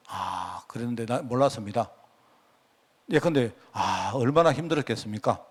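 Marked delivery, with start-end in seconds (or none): 0.1-1.9 much lower and softer, gently sympathetic; empathetic reply. Shown as a demonstration. A pastor modelling a sympathetic response, low and soft. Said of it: "맞장구를 쳐주는 겁니다". 3.7-5.4 empathetic reply. Shown as a demonstration. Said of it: "공감을 해주는 겁니다".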